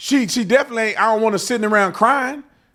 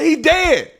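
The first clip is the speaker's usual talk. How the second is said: ordinary delivery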